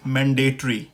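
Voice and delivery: मैंडेटरी as man-day-tree; British pronunciation. Shown as a demonstration. Teacher gives the next word, British pronunciation. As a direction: end मैंडेटरी on a squeezed tree. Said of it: "ये हो गया British में".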